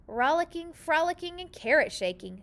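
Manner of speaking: usual speaking voice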